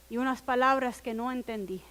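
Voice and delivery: usual speaking voice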